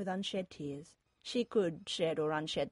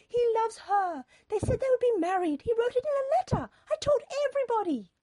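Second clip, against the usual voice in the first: shrieky high, loud protest; mother's cry; 8 dB louder than usual. A narrator's reading. Mother insisting they would be married, crying out. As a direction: wail each claim louder than talk, very high. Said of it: "Mother cried".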